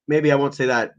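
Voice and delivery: ordinary talk, nothing marked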